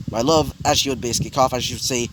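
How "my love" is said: plain reading voice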